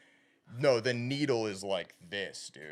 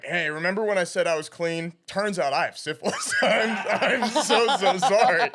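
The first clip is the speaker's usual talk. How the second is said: sheepish apology breaking into laughter, louder; laughing confession; 7 semitones higher, 9 dB louder than usual. Retold or imitated. Telling the girl he has syphilis, laughing apology. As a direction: apologize while cracking up.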